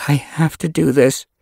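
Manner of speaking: usual speaking voice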